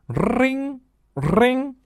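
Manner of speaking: high, sing-song ring ring; phone ring. Acted. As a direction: imitate a ringing phone, high.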